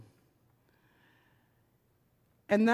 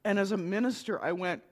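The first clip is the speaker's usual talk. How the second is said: usual speaking voice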